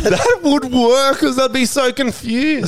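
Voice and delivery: high, slow, talking through laughter; laughing. Real, not acted. Friends joking about a pickup line, real laughter. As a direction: squeal it out while cracking up.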